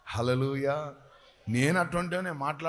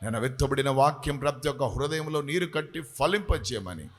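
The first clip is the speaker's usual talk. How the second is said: calm closing prayer; 4 semitones lower than usual. Real, not acted. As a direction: settle the prayer down gently. Prayer settles to close.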